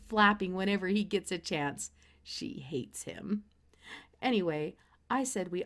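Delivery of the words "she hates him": slow whispered aside; hushed confiding whisper. A narrator's reading. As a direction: lean in and whisper it slowly. Sister hates the rooster.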